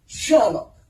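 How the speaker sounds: sharp, loud, cross call of a name; acted scolding shout. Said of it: "be very cross with him".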